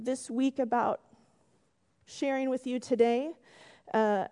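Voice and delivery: ordinary talk, nothing marked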